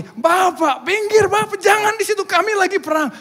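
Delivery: very high, loud yelling; frantic shouted warning. Acted. Warriors to foreigner in the crossfire, frantic shouting. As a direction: shoo him urgently off the battlefield.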